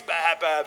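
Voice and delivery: loud mock bawling; imitated baby cry. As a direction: bleat like a demanding baby. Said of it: "wird geschrien". (Babygeschrei nachgeahmt)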